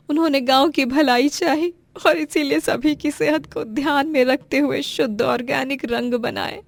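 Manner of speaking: high, anguished plea to God; tearful prayer. Acted. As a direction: beg God through tears, slowing at the end. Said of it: "भगवान से प्रार्थना करती है". (रोते हुए भगवान से गुहार)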